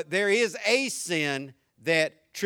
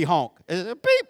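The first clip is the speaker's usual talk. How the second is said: usual speaking voice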